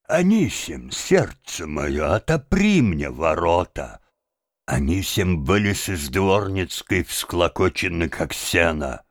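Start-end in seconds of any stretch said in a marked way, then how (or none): none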